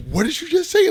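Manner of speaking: outraged father confronting his son; shocked angry dad. Retold or imitated. Dad overhears son cuss at brother, loud high outraged.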